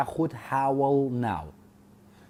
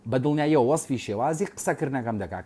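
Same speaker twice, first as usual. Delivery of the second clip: usual speaking voice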